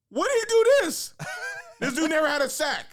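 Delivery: high, loud disbelieving outburst; incredulous coach. Retold or imitated. Coach questioning a player's made-up record, incredulous. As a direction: imitate a baffled coach blurting it out.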